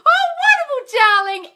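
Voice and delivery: high, loud gushing oh wonderful darling; thrilled for a viewer; gushing delight. Real, not acted. Coach gushing over a viewer's good news, loud and high. Reading a happy viewer comment.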